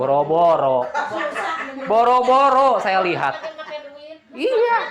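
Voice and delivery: loud laughing high rise; cracking up at his own joke; laughing aloud. Real, not acted. Preacher jokes nobody got thinner, laughing loudly.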